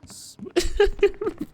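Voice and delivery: high frightened giggle; nervous laugh. Real, not acted. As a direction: laugh through the fear.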